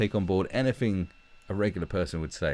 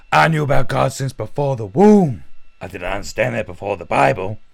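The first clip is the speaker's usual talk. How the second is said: loud, higher-pitched intense rambling; intense old man; 6 semitones higher, 13 dB louder than usual. Acted. Random old guy accosting a hotel guest, intense mock rant. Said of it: "getting that intense in your face".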